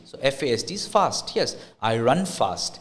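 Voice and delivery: ordinary talk, nothing marked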